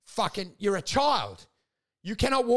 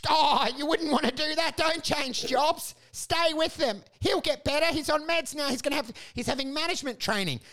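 mocking cautious advisers; 7 semitones higher than usual. Mocking well-meaning advisers.

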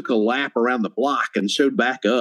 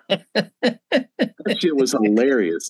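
ordinary talk

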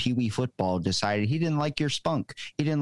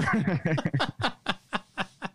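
high laugh; 10 semitones higher than usual. Real, not acted. Laugh after old man bit.

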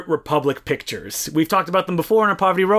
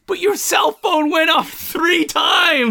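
exasperated shouted retort; 11 semitones higher than usual. Acted. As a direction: cry out in hammed-up exasperation. Acted retort to angry moviegoer.

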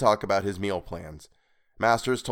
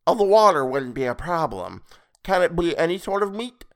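creepy high voice; 8 semitones higher, 5 dB louder than usual. Acted. Creepy stranger character voice.

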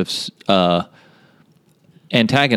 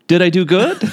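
naive Groot imitation; 9 semitones higher than usual. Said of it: "turns around to the others like".

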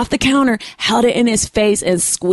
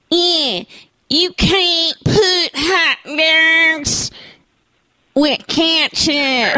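hammed-up Chicago accent, high and very drawn out; 7 semitones higher, much slower than usual. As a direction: drag every vowel like a caricature Chicagoan. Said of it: "that's my famous Chicago accent".

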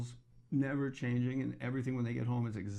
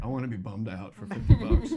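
loud, laughing mock gloom; mock mopey fan; 10 dB louder than usual. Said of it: "go like".

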